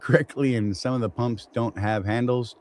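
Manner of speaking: normal talking voice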